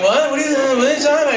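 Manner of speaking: high, rushed sleepy mumble; half-asleep wife. Retold or imitated. Wife woken in the night, high groggy mumbling. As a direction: mumble it fast and high, barely awake. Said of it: "She's half asleep".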